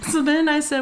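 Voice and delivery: ordinary talk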